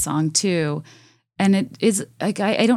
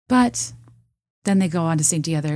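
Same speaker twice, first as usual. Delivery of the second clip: usual speaking voice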